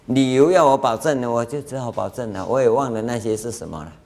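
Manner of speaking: ordinary talk, nothing marked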